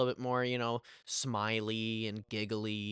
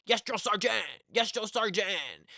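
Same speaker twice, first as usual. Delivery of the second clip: imitated yelling; 6 semitones higher, slower than usual. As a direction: mimic yelling to a sergeant, dragging out the last one. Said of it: "yelling nonetheless".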